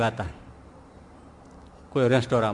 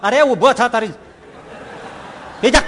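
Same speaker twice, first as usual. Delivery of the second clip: angry order; 6 semitones higher, 6 dB louder than usual. Acted. Hanuman again orders him.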